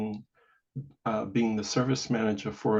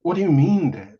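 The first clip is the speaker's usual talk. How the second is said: louder, higher, disbelieving question; taken-aback protest; 5 semitones higher, 7 dB louder than usual. Retold or imitated. Child challenging Dad's verdict on the job, taken aback. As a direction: push back in retold dismay.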